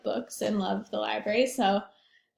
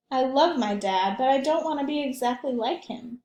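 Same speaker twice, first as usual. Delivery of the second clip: lively picture book read aloud; gently animated storytime voice; reading to children; 3 semitones higher, 4 dB louder than usual. A narrator's reading.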